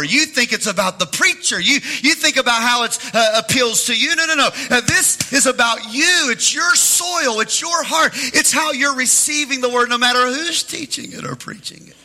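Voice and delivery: high, loud preacher's shout; preaching climax. Acted. Pastor confronting listeners about their hearts, shouted preaching. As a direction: hammer it home to every heart.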